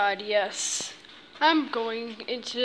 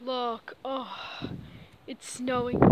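ordinary delivery

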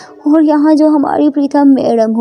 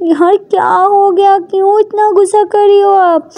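plaintive pleading; 8 semitones higher than usual. Acted. Ishani asks Prita why so angry, plaintive. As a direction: plead upward, stretching the first words.

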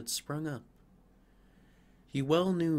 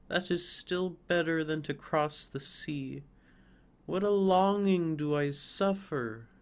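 higher, louder, ardent yearning; longing fir; 5 semitones higher, 4 dB louder than usual. Acted. Fir yearning for Christmas and the warm room. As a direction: pour out the longing. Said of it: "cried the tree, rejoicing".

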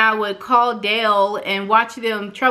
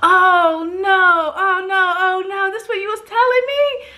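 high, sung-out oh no, much higher; dismayed realization; 8 semitones higher than usual. Retold or imitated. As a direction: whine oh no oh no as it dawns. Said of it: "I was like".

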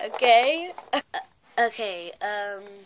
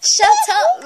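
high, loud yell through laughter; laughing shout; 16 dB louder, slower than usual. Real, not acted. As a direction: yell it while giggling. Telling friend off while laughing.

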